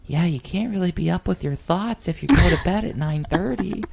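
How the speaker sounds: mock the guy with a high smug voice; imitating Scott lecturing them about bedtime; nerdy guy voice. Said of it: "one of those voices sounded like you".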